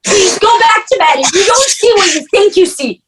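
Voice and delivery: frightened pleading. Acted. Sister pleads with raving brother.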